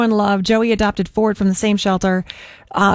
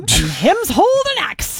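dog voice; 6 semitones higher than usual. Pretend. Baby talk about her dog.

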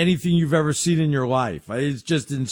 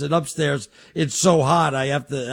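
ordinary delivery

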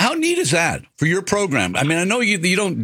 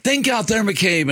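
mock yell; 7 semitones higher, slower than usual. Someone yelling at a hockey player on the ice, mock yell. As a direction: imitate a shout across the ice in a raised pitch. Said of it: "somebody yelled".

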